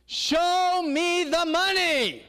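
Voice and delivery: high, drawn-out "Show me the money" yell; movie line shout. Acted. Preacher acting the movie star's demand, shouting. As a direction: bellow it like the football player on the phone.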